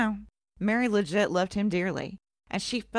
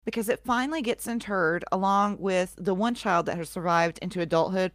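ordinary talk, nothing marked

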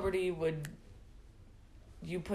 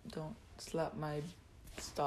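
trailing off; 3 semitones lower, 9 dB quieter, slower than usual. Real, not acted. First take, later repeated as clarification.

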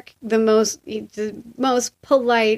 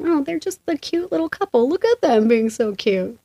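high-pitched mock swoon, slowing down; cooing retold gush; 6 semitones higher than usual. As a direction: gush over an adorable little couple. Gushing over couple in retold thought.

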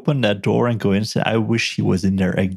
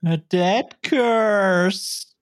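ominous doom voice; 12 semitones higher, much slower than usual. Pretend. Ominous quip after Crazy Ralph.

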